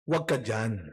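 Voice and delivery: put-on stern old church lady. Old church lady low and stern, shooing the young priest from her pew. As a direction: growl it low like a strict churchwoman shooing someone off. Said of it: "Sabi niya".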